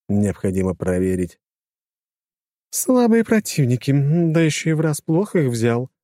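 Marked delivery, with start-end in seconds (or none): 2.7-5.9 high-voiced woman, mildly displeased. A narrator's reading. Lora to the narrator about weak opponents, displeased. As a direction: voice her grumble in a lifted pitch. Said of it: "недовольно произнесла Лора".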